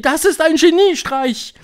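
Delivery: high, loud shriek of admiration; shrieked, high-pitched exclamation. A narrator's reading. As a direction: screech praise like an excited witch. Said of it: "kreischten sie".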